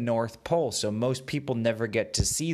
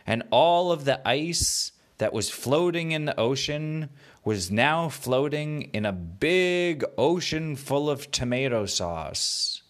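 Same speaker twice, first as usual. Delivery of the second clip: ordinary delivery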